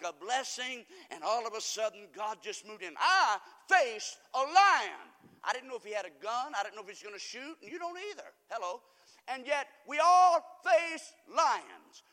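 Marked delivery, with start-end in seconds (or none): 3.0-5.1 drawn out, loud and high; slow, loud preaching. Acted. Preacher hammering the lion point to the church, slow and loud. 9.3-12.0 drawn out, loud and high; slow, loud preaching. Acted. Preacher hammering the lion point to the church, slow and loud.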